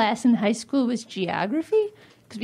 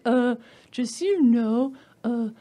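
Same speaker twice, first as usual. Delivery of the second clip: slow, lower pedantic correction; know-it-all heckler; 4 semitones lower than usual. Retold or imitated. Audience member correcting the comic slowly and pedantically. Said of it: "was like".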